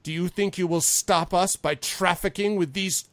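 slow, loud, high-pitched contempt; furious Envon. Acted. Envon turning on the lord who refused him, furious. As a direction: rage at them, leaning on each word. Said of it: "Now he's just pissed".

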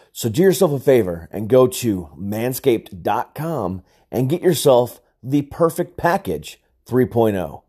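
loud, slow ad read. Acted. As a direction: sell it like a commercial. Reads sponsor ad.

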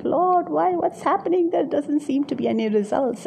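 high, pleading lament; anguished cry to God. Retold or imitated. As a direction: cry out to the Lord in despair. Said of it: "our cry is like".

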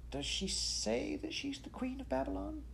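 high, soft, uneasy question; worried, tentative query. A narrator's reading. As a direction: ask nervously in a thin high hush. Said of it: "he uneasily asked".

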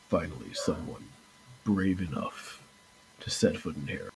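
a lightly voiced sinister host; villain greeting. Villain welcoming the heroes, soft low menace. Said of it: "turns and looks to you and goes".

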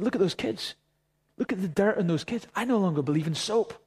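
hammed-up disbelief at dirty kids; mock exasperation. Pastor to his friend about muddy kids, mock exasperated.

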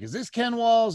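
eager caller. Retold or imitated. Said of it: "he's like".

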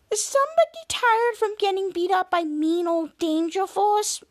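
lightly cooed, sing-song taunt; baby talk. Acted. Shadow mocking the tired Drex, in light baby talk. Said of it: "more baby talk".

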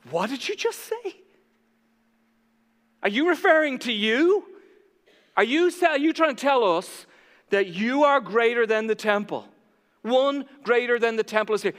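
outraged leaders. Acted. Preacher voicing leaders stunned by Jesus, high incredulous outrage. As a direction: sputter in scandalized disbelief.

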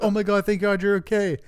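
high, hammed-up fake relief; mock relief. Wife pretending joy her husband lives, high fake gush. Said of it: "She's like".

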